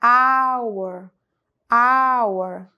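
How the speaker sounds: emphatic model of silent h; silent h, loud. Shown as a demonstration. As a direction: stress the A-sound start of "Hour,". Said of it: "eu falei com som de A".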